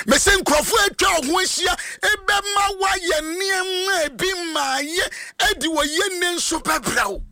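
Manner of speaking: very high, fast, crying sermon shout; impassioned preacher crying out. Acted. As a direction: warn them with a breaking, crying cry.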